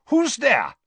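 high, loud, suspicious demand. Acted. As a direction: Karkaroff demanding answers in the dark. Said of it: "very suspiciously".

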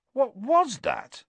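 high, slow, stunned disbelief; shocked question. Acted. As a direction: ask it utterly taken aback. Shaken after the cat attack.

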